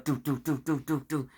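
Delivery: soft, rapid "do" thuds in a row; rhythmic mouth-made punching noises; imitated blows. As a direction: sound out repeated hits, quietly. Imitates blows as police jam him.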